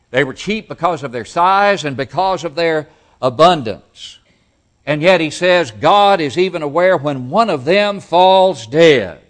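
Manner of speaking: high, very loud pulpit delivery; full-force preaching. Acted. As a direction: drive the point home loudly from the pulpit.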